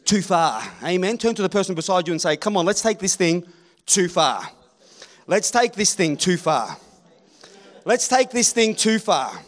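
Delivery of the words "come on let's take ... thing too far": drawn-out repeated slogan; congregation call-and-response. Acted. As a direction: lead the crowd in a chant. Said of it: "Repeat after me".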